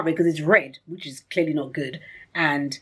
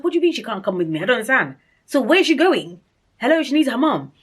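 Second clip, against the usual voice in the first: higher, rising protest; re-enacted upset; 7 semitones higher, 4 dB louder than usual. Mother told the baby can't come, upset retold protest. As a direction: protest in dismay, building higher and louder. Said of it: "I was so upset".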